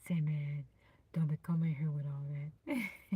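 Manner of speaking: low, put-on deep voice. Shown as a demonstration. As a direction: drop down low, playfully. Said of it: "in your deep voice".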